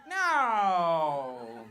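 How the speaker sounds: loud, drawn-out mock reassurance; loved ones protesting. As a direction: overdo the supportive denial. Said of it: "your loved ones are like".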